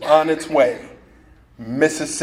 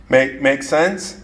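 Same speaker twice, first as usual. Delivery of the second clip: ordinary talk, nothing marked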